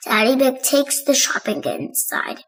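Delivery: ordinary delivery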